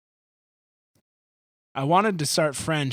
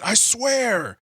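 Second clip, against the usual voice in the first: high, loud sing-song I swear; mock I swear; 11 semitones higher, 6 dB louder than usual. Anyone lying to a friend, in a high mock vow. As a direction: ham up a liar's promise. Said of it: "anytime anyone ever says".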